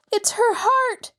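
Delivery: high, slow alarmed exclamation; alarmed cry. A narrator's reading. Mrs Potter as her friend collapses, alarmed cry. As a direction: cry out in fright. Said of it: "Missus Potter cried".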